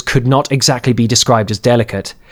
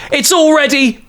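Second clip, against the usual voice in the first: voiced woman. A narrator's reading.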